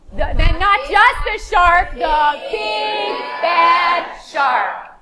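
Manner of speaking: very slow, sing-song build to the shark; dramatic reveal. Acted. Teacher names the shark at the door, dramatic. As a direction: savor every word of the big bad shark.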